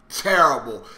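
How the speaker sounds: normal talking voice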